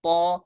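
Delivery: पौ said full and heavy from the back of the tongue, lips not rounded; heavy pur letter. Shown as a demonstration. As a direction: hold पौ heavy at the back of the mouth. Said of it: "पुर पढ़ते वक्त lips को round नहीं करना है".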